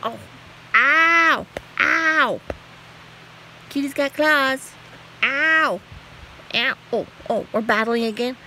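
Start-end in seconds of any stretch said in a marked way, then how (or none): 0.7-6.7 high, loud yelps of pain; real pained ows. Real, not acted. Owner yelping as the cat claws her, real pain. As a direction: cry out as claws hit.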